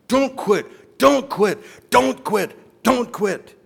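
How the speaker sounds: high, loud repeated shout; coach yelling. Retold or imitated. Football coach screaming at player during death crawl. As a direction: scream it right in his face. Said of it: "he keeps yelling".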